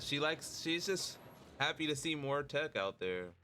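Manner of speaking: ordinary delivery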